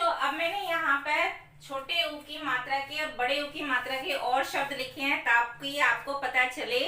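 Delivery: ordinary talk, nothing marked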